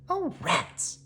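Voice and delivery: high, soft "oh rats"; disappointed little witch. Acted. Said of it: "said Maggie".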